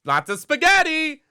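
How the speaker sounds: high, loud cartoon Italian accent; hammed-up Italian accent imitation; Luigi. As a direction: sing out slowly in a broad Italian accent. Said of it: "then he would have the Italian accent".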